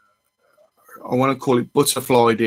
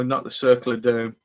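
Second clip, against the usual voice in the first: normal talking voice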